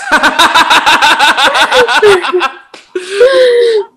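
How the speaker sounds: long, high, hearty laugh; loud burst of laughter. Real, not acted. Big laugh at the guest's joke.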